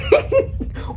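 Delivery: airy high cackle; high mocking laugh. Imitating officers cackling at her panic. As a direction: laugh like the cops did at her.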